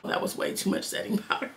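fast whisper; whispered aside. Real, not acted. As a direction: whisper it under your breath. Aside about too much powder.

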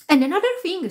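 high-pitched complaining worker; agitated stage hand. A narrator's reading. Stage hand to stage manager, agitated high voice.

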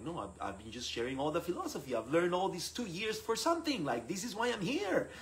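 increasingly high-pitched self-justifying; indignant protest. Retold or imitated. Frustrated student defending his efforts to the priest, indignant. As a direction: protest with pitch climbing to the end.

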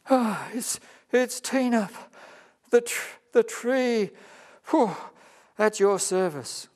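high, halting, puffing, strained words; out of breath. Acted. Worn-out tree pants her offer to the kangaroo. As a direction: gasp through it after carrying the kangaroo. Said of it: "Tina staggered to a rock".

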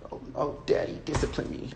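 breathless whispered plea; whispering, imitating a woman in bed; mock whisper. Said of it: "the woman says".

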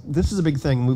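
ordinary talk, nothing marked

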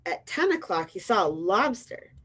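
ordinary delivery, nothing marked

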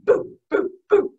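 short, separate little puffs; Baby Ghost boos. Retold or imitated. Baby Ghost joins the haunting, short boos. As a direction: puff out tiny ghost boos. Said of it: "Baby Ghost would go".

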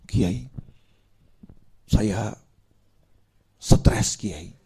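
low, soft, half-whispered complaint to the kiai; mock stressed plea. Stressed man begging the kiai for help, in a soft whining plea.

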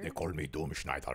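deep dramatic voice. Pretend. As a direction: announce it like a menacing villain. Playful intro of drummer.

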